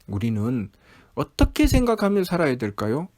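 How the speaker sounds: ordinary delivery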